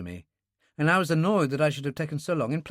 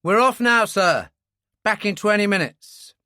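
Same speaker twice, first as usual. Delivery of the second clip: louder, higher call across the cabin; 6 semitones higher, 7 dB louder than usual. A narrator's reading. Joss calls to the doctor while leaving, loud. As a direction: call it over the noise.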